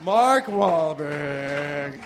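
very slow, low trailer announcement; dramatic title drop. Acted. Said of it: "That was a terrible impersonation".

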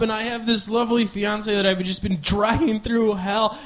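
ordinary talk, nothing marked

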